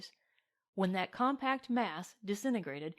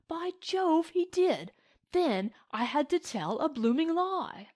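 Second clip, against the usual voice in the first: high, agitated British toff, growing louder; flustered Englishman at the climax of his tale; excited English gentleman; 6 semitones higher, 3 dB louder than usual. Acted. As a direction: exclaim in scandalised English tones.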